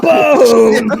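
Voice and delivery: a loud, high mock "Boom!"; shouted explosion boom. Recapping Red Dwarf to a friend, a loud mock explosion boom.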